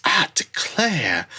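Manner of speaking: slow, high genteel drawl; Southern belle. Retold or imitated. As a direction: drawl it like a prim Southern lady. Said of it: "the other secretary just goes".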